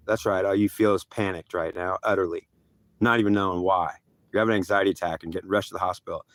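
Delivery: low, subdued voice of God; ominous pronouncement. Retold or imitated. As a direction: drop the voice and state it grimly. God's ominous answer, acted.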